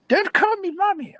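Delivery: put-on high, squealing old-lady voice; indignant old woman. Homeless woman objecting to the guard, high mock indignation.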